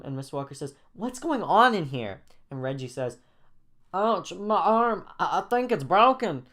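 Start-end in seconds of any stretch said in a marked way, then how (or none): 0.9-2.2 raised, startled question; alarmed. A narrator's reading. Babysitter rushes in, alarmed. 3.9-6.5 put-on high, slow, pained kid voice; hurt boy. A narrator's reading. Boy hurt after falling downstairs.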